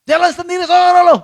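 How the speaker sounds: put-on man rudely telling his girlfriend to walk home, loud and brusque; rude retort. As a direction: snap it at the boyfriend rudely. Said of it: "Kasar banget sih".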